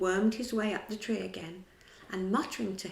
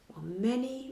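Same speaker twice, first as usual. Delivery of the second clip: plain reading voice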